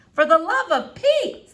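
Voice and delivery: exasperated Flora. A narrator's reading. Girl fed up with the doctor, laughing. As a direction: laugh in disbelief.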